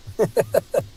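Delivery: quick, high-pitched chuckle. Real, not acted. Streamer amused by own memory-wipe story, chuckling. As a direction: laugh in short, even bursts.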